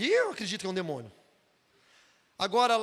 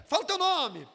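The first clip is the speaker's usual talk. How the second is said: louder, higher mock command; mock demand; 6 semitones higher, 6 dB louder than usual. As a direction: imitate someone insisting with a loud order. Imita quem exige o nome. (ordem imitada, em voz alta)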